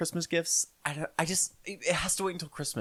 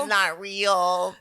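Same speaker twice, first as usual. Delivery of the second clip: loud, slow, higher jeer; mock taunting kid; 4 semitones higher, 7 dB louder, much slower than usual. Kid spoiling Santa for other children, mock taunting. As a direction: drag it out like a schoolyard spoiler.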